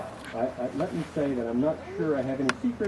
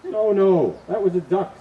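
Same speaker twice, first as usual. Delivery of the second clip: loud, higher, insistent husband; husband's loud reply; 9 semitones higher, 12 dB louder than usual. Acted. Husband corrects his wife, loud and insistent. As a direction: correct her firmly, raising the voice. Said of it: "said the husband".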